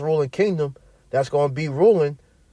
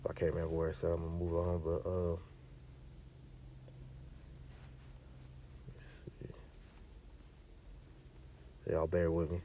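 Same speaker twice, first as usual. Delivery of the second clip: much lower and softer, under his voice; searching aside; 10 semitones lower, 24 dB quieter than usual. Real, not acted. Preacher asks listeners to bear with him while finding a verse, very quiet low aside. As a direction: talk quietly to yourself while hunting for the place.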